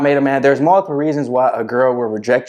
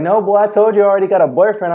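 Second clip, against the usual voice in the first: higher, fast, mock girl's brush-off; higher quick mock girl's voice brushing him off; imitating the girl; 8 semitones higher, faster than usual. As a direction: tease him in a lightly put-on girl's voice. Retold girl turns him down.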